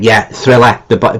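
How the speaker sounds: ordinary delivery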